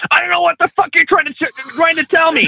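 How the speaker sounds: fast, very high-pitched mock rant; retold mad outburst. Trucker imitating a student snapping at his trainer, mad. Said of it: "he gets mad at me".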